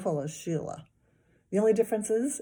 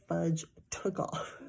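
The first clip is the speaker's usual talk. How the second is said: ordinary delivery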